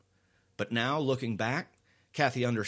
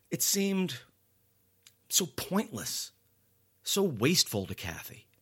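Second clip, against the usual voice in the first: rising dismay; 8 semitones higher than usual. A narrator's reading. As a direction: lift it in dismay. Dismay at pointless killing.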